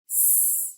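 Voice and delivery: "S-P-O-I-L" sound by sound, long pauses between; tapping out sounds. Shown as a demonstration. Teacher tapping out a spelling word, sounds isolated. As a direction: sound out each part of "S-P-O-I-L" separately. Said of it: "let's tap that out".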